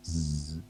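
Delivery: Zzz as a long buzzed z; letter sound demo. Shown as a demonstration. Asking for words beginning with the zebra's sound, Zzz softly buzzed. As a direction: hold Zzz as a soft, steady buzz. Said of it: "Zigzag Zebra's sound".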